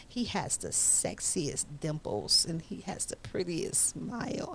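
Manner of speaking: hushed gushing whisper. Retold or imitated. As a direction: breathe it like a secret crush. Mother gushing about Harvey at lunch.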